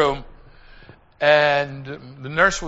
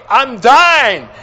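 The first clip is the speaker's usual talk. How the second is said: loud, high cry of a dying man; wailed, falling at the end; desperate cry; 8 semitones higher, 12 dB louder, much slower than usual. Retold or imitated. Retells dying man's desperate cries.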